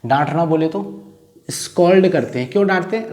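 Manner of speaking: usual speaking voice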